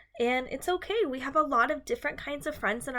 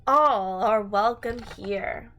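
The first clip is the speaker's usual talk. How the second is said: bright, sing-song picture book reading; reading to children; 3 semitones higher, 5 dB louder, slower than usual. Acted. Teacher reading a rhyming book to her class, sing-song. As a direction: read the rhyme to a class, lilting and lively.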